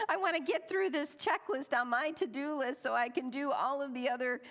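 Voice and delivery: hammed-up frantic holiday overload; frantic rush. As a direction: pile it on in a comic frenzy. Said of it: "I thought".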